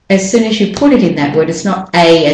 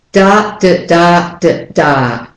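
"da, da, da, da, da" alternating full and short beats; stress rhythm; slower than usual. Shown as a demonstration. Teacher beating out the word's rhythm, alternating stresses. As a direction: chant "da, da, da, da, da" as long-short syllable rhythm. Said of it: "The rhythm of this word is".

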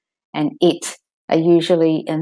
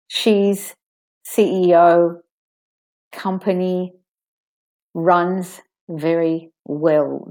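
She's, C E O, company, runs very well said as separate stressed words; "runs very well" drawn out slowly; only stressed words; slower than usual. Shown as a demonstration. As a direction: pick out each stressed word slowly. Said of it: "And I'm stressing the important words".

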